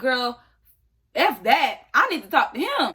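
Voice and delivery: fired-up retort. Retold or imitated. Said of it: "So I'm like".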